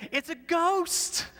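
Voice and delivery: high, slow, mock-terrified cry; high, drawn-out frightened shout of the disciples. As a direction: voice the panicked disciples shrieking at a ghost. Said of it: "they were terrified".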